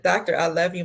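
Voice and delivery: usual speaking voice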